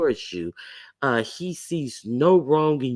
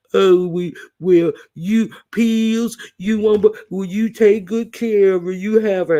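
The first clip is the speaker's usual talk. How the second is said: higher, louder mock of a pleading man; imitating Malik in a higher, louder voice; 6 semitones higher, 5 dB louder than usual. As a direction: ham up a man handing his wife over. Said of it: "He sounded".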